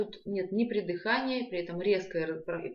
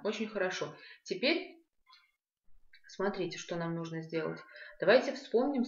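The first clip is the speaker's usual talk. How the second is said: normal talking voice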